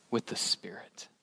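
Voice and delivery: breathy whispered emphasis on the Spirit. Real, not acted. Preacher whispers the key to his congregation. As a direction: lean in and whisper it.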